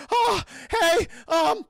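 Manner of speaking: high, loud, panicky stammer; Morty voice. Retold or imitated. Military guy stumbles out, high panicky loud yelp. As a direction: yelp it like a cartoon nervous teen. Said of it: "He's like fucking Morty".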